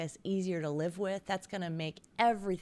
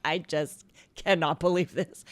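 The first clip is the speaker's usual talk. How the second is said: exasperated; 6 dB louder than usual. Retold or imitated. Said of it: "I was just like".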